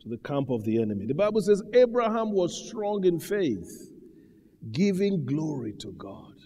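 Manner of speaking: measured preaching cadence; preacher's measured exhortation; sermon delivery. Acted. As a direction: preach it steadily to the congregation.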